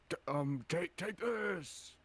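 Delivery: high, loud, drawn-out battle cry; attack cry. Acted. Attacker to giants as the spell strikes, attack cry. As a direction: shout it as you strike.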